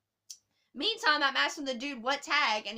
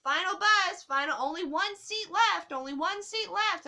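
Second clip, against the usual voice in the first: higher, louder mock boarding call; announcer call; 6 semitones higher, 4 dB louder than usual. Staff announcing the last bus, mock boarding call. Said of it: "making announcements saying".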